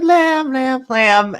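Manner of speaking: high, loud cooing "Lamb, lamb"; high cooing kid voice. Retold or imitated. Kyle fussing over the goat, cooing high. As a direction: coo over the goat like a fond child.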